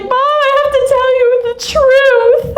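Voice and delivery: very high tearful wail; imitated crying child. Crying daughter confessing to mother, high tearful wail. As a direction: squeal it out like a sobbing kid confessing. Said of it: "she's crying and we're both crying".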